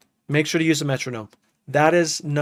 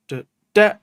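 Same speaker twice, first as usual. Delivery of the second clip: Do, da sung high and loud as accents; accented offbeat; 6 semitones higher, 7 dB louder than usual. Shown as a demonstration. Demonstrating offbeat accents to viewers, punchy syllables. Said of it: "this is the offbeat".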